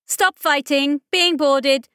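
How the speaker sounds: high loud commanding shout; mechanical shout. Acted. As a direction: snap it out over comms. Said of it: "a mechanical voice snapped out".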